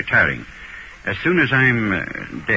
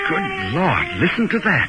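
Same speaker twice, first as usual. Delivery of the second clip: startled; 12 semitones higher, slower than usual. Acted. Winthrop hears the voice laughing.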